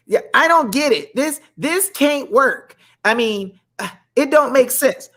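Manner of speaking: ordinary delivery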